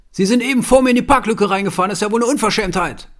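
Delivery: fast, loud and high scolding; indignant public scolding; angry accusation. Retold or imitated. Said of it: "und schreit".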